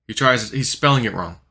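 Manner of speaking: ordinary talk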